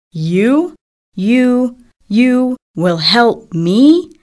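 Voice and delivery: incredulous, each "you" set apart; mocking disbelief. A narrator's reading. Lion laughs at little mouse's offer, mocking disbelief. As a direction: draw it out into a rising, scoffing question.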